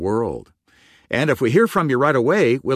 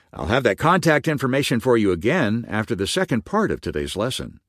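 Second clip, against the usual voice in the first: normal talking voice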